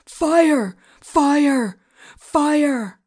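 high, drawn fire alarm cries; calling out. A narrator's reading. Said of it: "Joe called out again".

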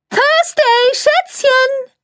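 very high, shrill shriek of delight; excited press lady squeals a greeting; shrieking gushing woman. A narrator's reading. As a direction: gush and squeal her name across the hall. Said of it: "kreischte Cordelia Flack".

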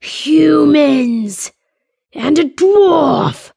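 troll spotting the charging companions; troll shout. A narrator's reading. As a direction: raise the alarm like a startled troll. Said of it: "the other shouted".

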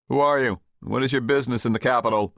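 puffed-up under-officer, demanding and fast; pompous gate guard. A narrator's reading. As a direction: bark the challenge like a petty official. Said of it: "puffed up with his own petty authority".

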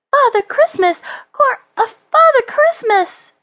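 put-on very high, excited child's voice; high-pitched boy, astonished; amazed disbelief. A narrator's reading. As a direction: exclaim in stunned wonder, pitch way up. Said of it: "unable to believe his eyes".

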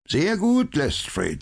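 high, smiling mock praise. A narrator's reading. Holmes praising Lestrade with an ironic smile. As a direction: pat him on the head with a smile. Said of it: "sagte Holmes und lächelte".

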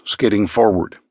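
plain reading voice

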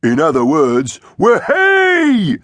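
jubilant high cheer, rising to a loud whoop. A narrator's reading. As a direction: build to a soaring celebratory yell. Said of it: "cheered Iggy".

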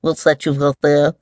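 mildly annoyed mole; understated grumbling question; annoyed mumble. A narrator's reading. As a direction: mumble with a touch of irritation. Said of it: "the mole mumbled, annoyed".

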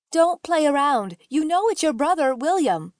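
reply with a touch of sharpness; sharp reply. A narrator's reading. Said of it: "she replied sharply".

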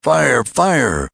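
a voice in the street raising the alarm, crying out high and clear. A narrator's reading. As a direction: shout it out across the street. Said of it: "high and clear, the cry rang out".

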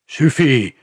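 high, loud, abrupt yell. A narrator's reading. Pastor stopping the crowd, yelling. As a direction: yell to cut them off. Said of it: "hurla le pasteur".

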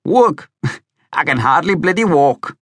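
high, incredulous laughing comeback; laughing retort. A narrator's reading. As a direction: laugh off the very idea. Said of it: "he'd laugh".

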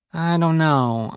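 slow, drawn-out hedge; hedging. A narrator's reading. Narrator answering Kit's invitation home, hedging slowly. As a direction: stretch the words out, noncommittal. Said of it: "I hedged".

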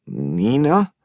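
name asked back with a rising tune; puzzled echo. Acted. Hungover man repeats the caller's name, puzzled and rising. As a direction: echo the name, not placing her.

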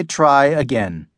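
usual speaking voice

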